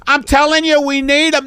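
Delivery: high, insistent boss voice; insistent photographer. Acted. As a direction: press the point on a balky model. Photographer overrules the others.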